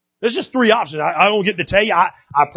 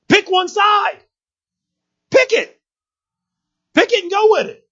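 urgent shout; 12 semitones higher than usual. Real, not acted. Preacher shouting at the youth to pick a side. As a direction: shout the call to choose a side.

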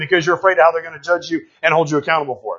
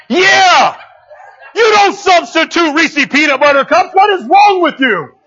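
high, loud outraged shout; hammed-up fury at a fake candy cup; mock outrage; 9 semitones higher, 3 dB louder, slower than usual. Preacher mock-yelling at the church over fake Reese's.

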